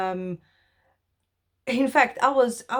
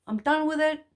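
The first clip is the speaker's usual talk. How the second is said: higher, with firm resolve; emphatic repeat; 8 semitones higher, 4 dB louder than usual. Real, not acted. Speaker tells viewers she's done with hiding, higher emphatic repeat. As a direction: say it again higher, meaning it.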